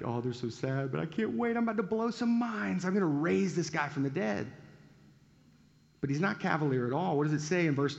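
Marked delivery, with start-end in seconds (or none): 0.0-4.5 smug inner glee. Retold or imitated. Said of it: "I'm kinda laughing to myself".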